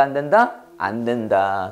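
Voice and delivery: normal talking voice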